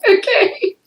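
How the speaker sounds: high-pitched words through laughter; laughing. Real, not acted. Client amused by own grocery store memory, laughing. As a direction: let giggles break up the words.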